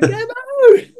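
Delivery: high drawn-out call of the name. Retold or imitated. Ali Williams greeting him again, high drawn-out call. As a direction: sing out the nickname.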